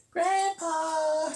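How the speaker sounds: put-on high, sweet little girl calling. Granddaughter calling her grandpa to the phone, high child voice. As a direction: call out like a small granddaughter. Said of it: "And she'd say".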